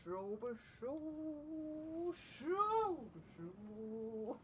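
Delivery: long drawn-out whooshing syllables, rising high; imitated driving noise. Driver sat there calmly approaching a junction, imitated whooshing. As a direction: act out a car gliding along in swoops. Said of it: "Dan was sat there going".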